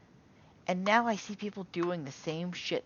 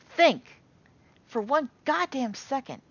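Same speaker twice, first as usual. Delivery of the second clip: higher and much louder, yelling think for one goddamn second; exasperated shout; 8 semitones higher, 8 dB louder than usual. Real, not acted. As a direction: shout it at the audience in frustration. Exasperated plea to his audience.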